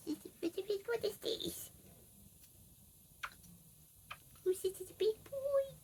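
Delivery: very high, slow cooing. Real, not acted. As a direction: croon to the dog. Talking baby talk to pet.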